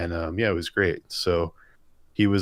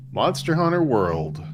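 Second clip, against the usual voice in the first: high, drawn-out gush; gushing enthusiasm; 7 semitones higher, 3 dB louder, slower than usual. Real, not acted. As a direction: stretch the title out high, then swoop down. Gushing answer about favourite game.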